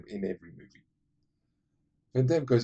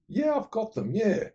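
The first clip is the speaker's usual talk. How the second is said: pitched up, casual; light high mock reply; 12 semitones higher than usual. Van Damme answering about the groceries, pitched-up mock reply. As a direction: toss it off brightly.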